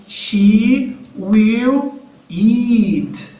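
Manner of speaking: she will eat said very slowly; teacher spelling it out; slow model phrase. Shown as a demonstration. Teacher modelling she will eat slowly. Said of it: "vai ficar".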